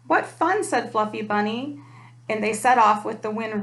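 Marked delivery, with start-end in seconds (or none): 0.1-0.6 high, bright-eyed burst of delight. A narrator's reading. Fluffy Bunny answers the ponies, high excited squeal. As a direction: squeal it with glee.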